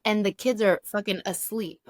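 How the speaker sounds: ordinary delivery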